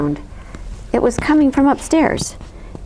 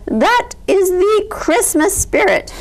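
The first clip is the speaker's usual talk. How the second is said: grand proclamation; 10 semitones higher than usual. A narrator's reading. Bear continues bellowing.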